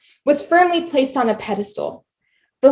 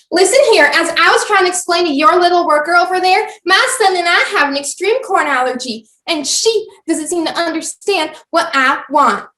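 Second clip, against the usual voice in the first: loud, high demanding customer; Karen voice; 5 semitones higher, 3 dB louder than usual. Acted. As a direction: demand to speak to the manager. Karen confronting restaurant staff.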